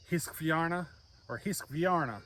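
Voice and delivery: slow Swedish-accented "Hiskvarna" with an "ih" vowel; mock Swedish "Hiskvarna". Shown as a demonstration. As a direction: say "Hiskvarna" as a Swede might, drawn out. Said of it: "think of it in a Swedish ask-, accent".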